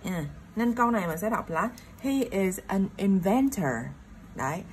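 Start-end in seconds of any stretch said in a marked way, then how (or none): 2.0-3.7 "inventor" with the beat on "ven"; stress on second syllable of inventor. Shown as a demonstration. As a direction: lean on "ven" in "inventor", teacher demonstrating. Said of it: "nhấn tập trung vào chữ van". (nhấn vào âm hai "ven" trong "inventor")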